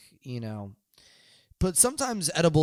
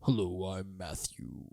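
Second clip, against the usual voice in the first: imitating Matthew; 6 semitones lower, 9 dB quieter, slower than usual. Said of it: "Matthew's very".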